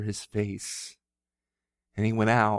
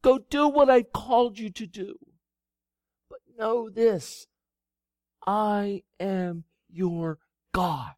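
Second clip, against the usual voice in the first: high, loud, slowing commands fading to a calm close; command, then settle into I am your God; God sending Elijah back to life; 11 semitones higher, 6 dB louder, slower than usual. Acted. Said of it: "He said".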